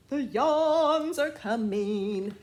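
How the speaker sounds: loud, slow, spooky announcement; dramatic title read. Acted. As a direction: build suspense like a monster is coming. Reads book title dramatically.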